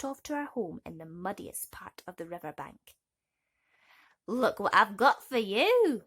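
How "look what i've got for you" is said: slow, much louder call. Acted. The mother toad calling to her son, loud. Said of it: "she called to her son".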